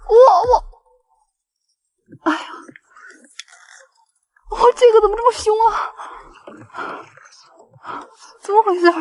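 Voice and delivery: high, fast excited stammer; flustered as the fish pulls hard. Real, not acted. Fish fighting hard on line.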